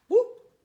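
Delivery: sudden loud, high hooting call; chimp whoop. Acted. Greeting Old Flo the chimp with a loud high hoot.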